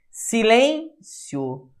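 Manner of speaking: Silêncio said very slowly, gliding through the final io; slow demo word. Shown as a demonstration. Teacher repeating the example so students hear it, very slowly. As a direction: stretch Silêncio out for the class. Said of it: "O I é a semivogal".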